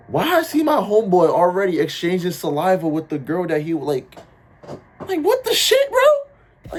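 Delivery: high, loud, amazed retelling; shocked disbelief. Retold or imitated. Telling how he caught his homeboy kissing, high shocked squeal.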